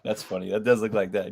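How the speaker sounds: usual speaking voice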